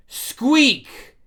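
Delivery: very high, loud squeal; yelled squeak. Acted. The hammer answers Loki, yelling a squeak. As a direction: squeal it like a small animal. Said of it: "And Ragnar yells".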